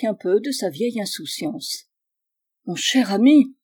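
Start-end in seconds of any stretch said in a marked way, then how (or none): 2.6-3.5 louder, urgent call to a friend; crying out. A narrator's reading. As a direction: call out to him in alarm. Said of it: "m'écriai-je".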